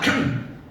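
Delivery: husband's meaningful ahem, imitated; signalling throat clear. Husband hinting to his wife for tea, throat clear. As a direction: clear the throat to drop a hint. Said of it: "Om kan bilang ahem".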